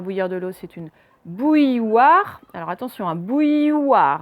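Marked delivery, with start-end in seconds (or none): 1.2-2.4 BOUILLOIRE drawn out slowly, "ouille" then "wa"; careful pronunciation. Shown as a demonstration. Teacher introducing a tricky word, slow careful pronunciation of BOUILLOIRE. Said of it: "un petit peu dur à prononcer". 3.1-4.2 BOUILLOIRE slow, the "ouille" sound held in the middle; careful pronunciation. Shown as a demonstration. Teacher warning pupils about the middle sound, slow BOUILLOIRE. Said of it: "on a bien le son ouïe au milieu".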